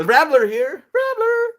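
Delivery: excited high shout; calling out. Real, not acted. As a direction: holler it out loudly, rising high. Excitedly calls out to Rambler.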